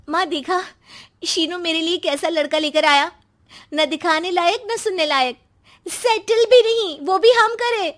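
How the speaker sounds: building from a sharp complaint to a high, loud outburst; angry at brother. Acted. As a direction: fume at the brother, then explode. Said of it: "उसे भाई पर गुस्सा आ जाता है".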